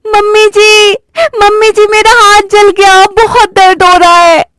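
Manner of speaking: high, whiny fake tears; fake crying. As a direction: bride pretending to be burnt and in pain. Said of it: "रोने लगती है". (ऊँची आवाज़ में झूठा रोना)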